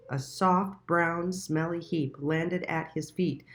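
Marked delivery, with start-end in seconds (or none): none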